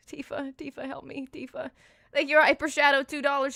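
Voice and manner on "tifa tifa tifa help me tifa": unvoiced pleading whisper; whispered plea. Gamer whispering a plea to Tifa for help. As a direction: beg Tifa under your breath.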